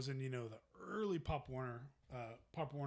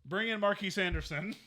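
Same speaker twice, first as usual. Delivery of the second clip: raised, louder summons; acted coach's call; 6 semitones higher, 8 dB louder than usual. Coaches summoning a player at practice, called out. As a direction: call it out across the practice field.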